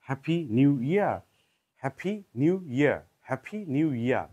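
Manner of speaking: হ্যাপি নিউ ইয়ার with aspirated p, nyoo and no r; British-accent happy new year. Shown as a demonstration. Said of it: "যদি আমরা ব্রিটিশদের মতো বলি". (British উচ্চারণে হ্যাপি নিউ ইয়ার)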